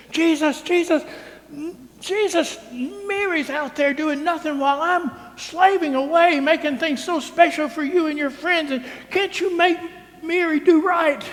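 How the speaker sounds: high, loud whining complaint; mad Martha. Retold or imitated. Martha complaining to Jesus about her sister, high and whiny. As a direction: whine at Jesus, exasperated and put-upon. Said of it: "She's mad".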